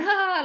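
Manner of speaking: high mock "ha ha"; imitated laugh. Everyone laughing at the heckled spirit, high imitated laugh. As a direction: act out the group's burst of laughter. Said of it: "like that".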